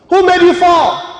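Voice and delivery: high, loud challenge; demanding shout. Real, not acted. Preacher challenges a church member about falling, demanding shout. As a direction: throw the question out hard. Presses congregant with a question.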